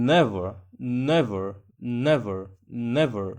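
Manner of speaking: slow, careful "নেভার" as NEVer with a clear final r; teacher drilling "নেভার" for students; English pronunciation demo. Shown as a demonstration. Teacher shows students "নেভার", slow and clear. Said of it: "দেখি নেভার কীভাবে উচ্চারণ করবেন".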